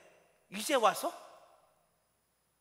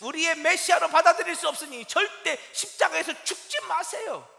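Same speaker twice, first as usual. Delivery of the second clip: pleading, louder, higher objection; Peter's protest; 8 semitones higher, 9 dB louder than usual. Acted. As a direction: plead and protest with rising force.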